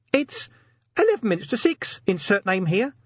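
high meek little voice; timid tiny imp. A narrator's reading. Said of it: "said the imp meekly".

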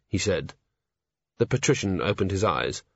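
usual speaking voice

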